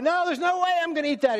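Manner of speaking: put-on a boy who won't touch it; indignant kid refusal. Boy refusing his mother's brownies, high and fast.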